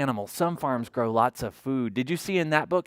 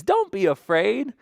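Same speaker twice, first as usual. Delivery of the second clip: ordinary delivery, nothing marked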